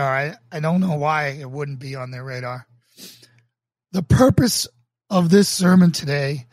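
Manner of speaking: sermon reading. Acted. Pastor reading his sermon to online viewers, earnest and emphatic. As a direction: preach it from the page with conviction.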